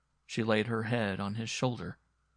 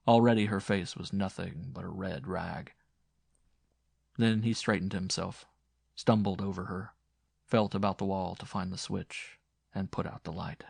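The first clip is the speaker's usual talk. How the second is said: ordinary delivery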